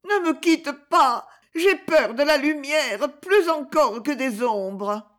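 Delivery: high, slow, desperate clinging cry; frightened madwoman pleading; panicked gasping plea. A narrator's reading. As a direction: beg in terror, drawing out each word. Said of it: "Violetta l'étreignait convulsivement et râlait".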